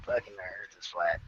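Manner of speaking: low, soft mumbled take on his friend; Ed's way of talking. Retold or imitated. Imitating Ed insisting the Earth is flat, low and soft. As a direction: drop low and quiet like Ed. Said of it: "And he kinda talks like this".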